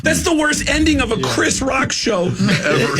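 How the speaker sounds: high, shouted comic protest; mock outrage. Comic objecting to following a star, high mock outrage. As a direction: cry it out in exaggerated disbelief. Acted protest, over laughter.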